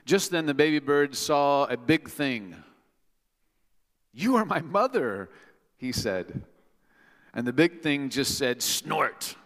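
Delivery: slow, dramatic storybook reading; reading to children. Acted. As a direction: build suspense for the kids.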